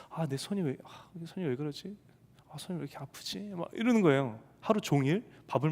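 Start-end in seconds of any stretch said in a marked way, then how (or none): 0.1-3.4 quiet complaint. Retold or imitated. Wife complaining of sore hand.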